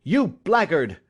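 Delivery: loud furious insult; shout. Acted. Enraged father shouting at his son, loud insult. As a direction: spit the insult out hard. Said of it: "I shouted".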